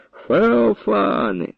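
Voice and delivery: high, long-drawn call of the name; frantic cry. A narrator's reading. Grandfather calling Feofan, frantic cry. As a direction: cry out, voice not your own. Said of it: "І крикнув не своїм голосом".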